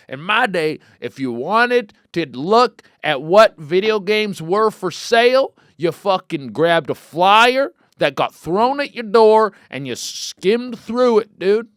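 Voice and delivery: high, slow mock old man grumbling; old-timer rant. Joking about the old days to listeners, mock old-timer voice. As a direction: play a crusty grandpa.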